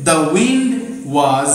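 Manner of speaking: slow, with pauses between words; slow dictated sentence; slow dictation. Shown as a demonstration. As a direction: read it out for note-taking. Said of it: "এখানে তোমরা লিখবে".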